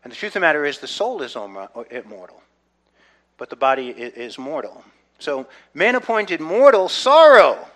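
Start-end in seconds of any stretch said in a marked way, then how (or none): 5.2-7.7 loud, higher, landing hard on sorrow; rising proclamation ending on sorrow; emphatic build. Shown as a demonstration. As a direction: preach it, punch sorrow. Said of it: "building the name meanings".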